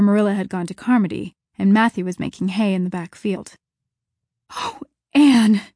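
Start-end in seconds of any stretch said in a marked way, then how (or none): 4.5-5.6 loud, high relieved gasp. A narrator's reading. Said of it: "gasped Diana".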